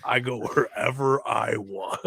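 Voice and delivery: put-on slow cocky accented boast; Razor Ramon impression. Fan quoting Razor Ramon's boast in a slow cocky accent. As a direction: lean into the swagger. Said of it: "I love when the Punk".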